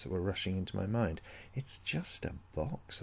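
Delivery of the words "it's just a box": quiet, slow words to herself; said to oneself. Acted. Narrator calming herself over the eerie box, quiet and slow. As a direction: talk yourself out of the fear, softly and slowly. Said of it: "I said to myself".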